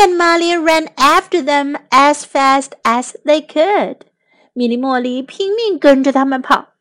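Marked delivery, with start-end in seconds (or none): none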